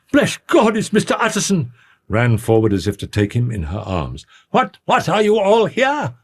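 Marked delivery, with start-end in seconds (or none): 0.1-1.7 very high-pitched cry of relief. A narrator's reading. Overwrought cook greeting Mister Utterson, crying out. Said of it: "crying out". 4.5-6.2 high, slow, peevish exclamation. A narrator's reading. Lawyer to the huddled servants, peevishly. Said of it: "said the lawyer peevishly".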